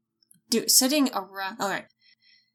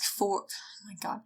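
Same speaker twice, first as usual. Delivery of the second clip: exasperated whisper; 3 semitones lower, 12 dB quieter than usual. Real, not acted.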